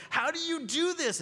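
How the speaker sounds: high, loud, slow disbelief; incredulous. Retold or imitated. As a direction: ask in loud amazed disbelief. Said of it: "and you're going".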